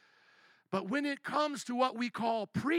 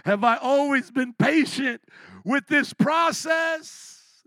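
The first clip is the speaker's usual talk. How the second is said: higher and louder, leaning hard on "always"; loud challenge; 5 semitones higher, 7 dB louder, slower than usual. Real, not acted. Preacher confessing impatience to the congregation, loud and rising. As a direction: throw the question out, confessing loudly. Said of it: "I can't even say it without laughing".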